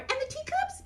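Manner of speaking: high puppet chime-in; Mimi. Acted. Mimi adds to the table setting, high puppet voice. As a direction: pipe up in a tiny, high character voice.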